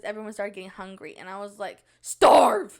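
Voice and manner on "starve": sudden loud yell, pitched up; mock outburst. Snapping at hungry companions in jest, mock shout. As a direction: yell it as a blunt order.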